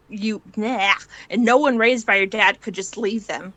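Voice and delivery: ordinary delivery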